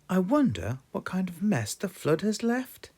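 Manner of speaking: high wondering granny voice; curious grandma peering out; Granny Pig voice. Acted. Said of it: "Granny Pig said".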